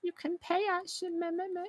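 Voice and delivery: squeaky high nonsense chatter; comic high-pitched babbling captor; silly high gibberish. Retold or imitated. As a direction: babble 'me me me' in a tiny high voice. Guy's gibberish voice.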